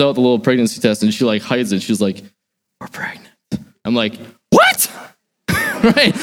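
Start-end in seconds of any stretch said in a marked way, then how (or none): 2.8-3.3 barely audible, secretive; hushed reveal. Retold or imitated. Wife reveals secret pregnancy news. 4.5-4.9 shrieking high, stunned; shocked yelp. Retold or imitated. Stunned reaction to pregnancy news. 5.5-6.1 laughing aside. Real, not acted. Laughs after retelling shock.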